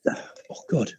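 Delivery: a soft whispered oh God; whispered to yourself in dismay; whispered aside. Real, not acted. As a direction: breathe it out under your breath. Muttered aside under breath.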